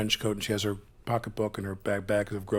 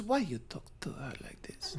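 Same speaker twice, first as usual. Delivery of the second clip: put-on whispered, with a heavy Russian accent; hushed Russian-accented teacher stopping the scene; Russian-accented acting coach whispering a correction; teacher's heavy accent; 7 semitones higher, 9 dB quieter than usual. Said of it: "obviously had a very heavy accent".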